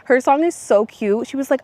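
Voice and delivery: usual speaking voice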